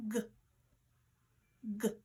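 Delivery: "g" as a short isolated consonant sound; sound dictation. Shown as a demonstration. Teacher dictating sounds to pupils, "g" as a lone short sound. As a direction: say just the quick sound of "g", pause, repeat. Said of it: "our sound dictation".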